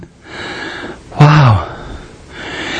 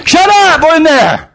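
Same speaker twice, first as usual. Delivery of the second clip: higher, irritated shout; annoyed yell; 8 semitones higher, slower than usual. Acted. Prisoner shouting at the singing cell, annoyed. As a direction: tell them to knock it off.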